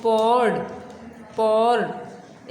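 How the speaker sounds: पॉर्ड with a faint r, neither clear nor silent; soft r in poured. Shown as a demonstration. Teacher modelling पॉर्ड for pupils, r barely heard. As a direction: say पॉर्ड with only a trace of the r. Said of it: "आर जो है बिल्कुल आपने clear भी नहीं बोलना".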